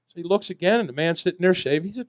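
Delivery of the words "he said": normal talking voice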